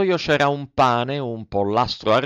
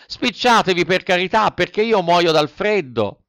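higher, louder begging; pleading puppet; 8 semitones higher, 4 dB louder than usual. A narrator's reading. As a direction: Pinocchio freezing begs the snail to hurry. Pinocchio pleading. (burattino implorante)

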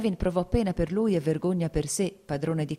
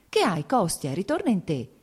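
plain reading voice

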